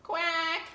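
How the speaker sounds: high quack; duck quack. Acted. Duck sound.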